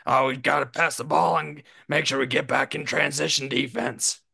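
a hint of a coach talking through a cough; mock coach's voice, half-hearted; light Doc Rivers impression. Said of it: "He literally sounds like he's coughing while he's talking".

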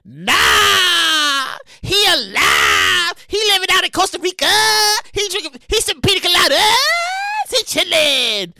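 hammed-up Carol revealing the news; mock Carol Baskin. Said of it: "comes out of nowhere and says".

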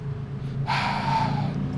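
long audible inhale and sigh; deep breath in. Retold or imitated. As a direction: draw a big breath and let it out. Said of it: "he was like".

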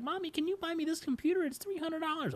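put-on very high pleading little-kid voice. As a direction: beg Mommy like a small child. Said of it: "I'd be like".

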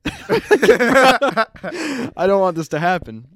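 laughing, slow and high. Real, not acted. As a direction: giggle through it.

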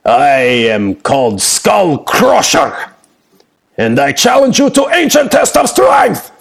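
rising, building to a shout; eager challenge. Acted. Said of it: "really quickly with, like, anticipation now".

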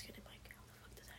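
hushed whisper. Real, not acted. Friend whispers a complaint about the missing mics. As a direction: lean in and whisper it.

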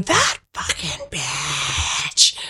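low, drawn-out furious character voice; Aunt Gertie. Acted. Aunt overheard cursing down the phone, venomous. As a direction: drag each word out with venom. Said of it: "you hear Aunt Gertie going".

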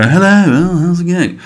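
high mock English accent; chirpy exaggerated English greeting accent; English accent impression. Showing how a few English really talk, light English accent. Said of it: "Like that".

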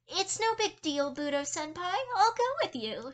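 high, bright schoolgirl sweetness; high fake-cute reassurance; sugary cheer. A narrator's reading. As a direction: sweet-talk him to the nurse. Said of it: "she responded".

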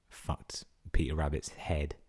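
ordinary talk

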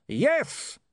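high, jubilant cry; triumphant shout. A narrator's reading. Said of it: "he shouted".

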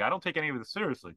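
normal talking voice

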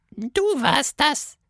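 very high, delicate little creature voice; a tiny hidden creature, cheekily. Acted. Caterpillar hiding from Tilda, very high delicate voice.

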